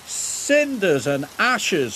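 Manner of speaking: high, drawn-out mild outburst; spluttering. A narrator's reading. Thomas exclaims after being shunted under the hopper, spluttering. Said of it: "spluttered Thomas".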